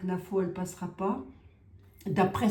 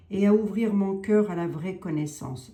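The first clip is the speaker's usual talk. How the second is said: a louder solemn invocation; louder prayer invocation; 2 semitones higher, 5 dB louder than usual. Acted. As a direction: proclaim "Et à ouvrir mon cœur à la vraie connaissance" to the saint. Prayer line, then repeats it.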